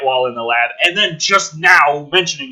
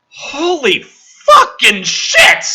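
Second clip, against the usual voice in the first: slow, high, loud disbelief; exasperated yell; 7 semitones higher, 4 dB louder, much slower than usual. Real, not acted. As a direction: shout it out slowly. Outburst at the script.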